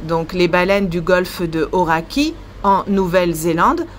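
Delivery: ordinary talk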